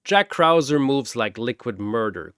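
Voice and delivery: ordinary delivery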